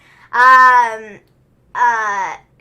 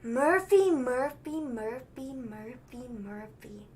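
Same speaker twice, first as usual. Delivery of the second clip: drawn-out name, fading to very soft; Seth Rollins; 4 semitones lower, 10 dB quieter, much slower than usual. Retold or imitated. Podcaster imitating a wrestler addressing Murphy, fading mock-weary repetition. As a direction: repeat the name slowly, sinking lower and quieter. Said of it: "And Seth Rollins is like".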